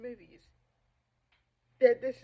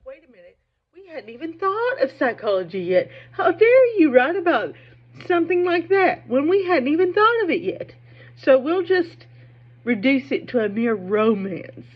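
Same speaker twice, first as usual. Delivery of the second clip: mocking men; 4 semitones higher, 12 dB louder than usual. Mocking how men reacted.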